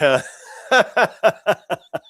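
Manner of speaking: a high, loud burst of laughter. Real, not acted. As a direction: crack up at your own pun. Laughs at own joke.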